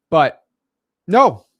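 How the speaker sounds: correcting the deal location; sudden correction. Real, not acted. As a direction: catch your own mistake out loud. Self-correction.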